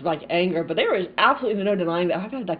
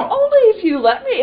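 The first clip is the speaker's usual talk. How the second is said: playful high; 14 semitones higher, 10 dB louder than usual. Real, not acted. Teasing reply, high.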